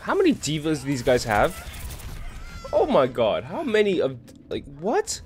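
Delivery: loud, high disbelieving outcry; can't believe how many there are; rising exasperated shout; exasperated disbelief. Real, not acted. Overwhelmed by enemy heroes.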